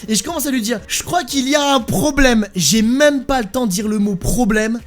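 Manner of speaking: ordinary talk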